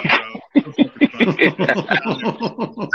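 helpless laughter breaking up the words; real, can't-stop laughing; laughing to tears. Real, not acted. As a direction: crack up until the words dissolve into laughing. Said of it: "I'm tearing up".

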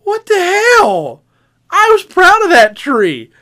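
high, loud indignant yelp; mock outrage. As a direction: shout it, offended. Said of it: "And I went".

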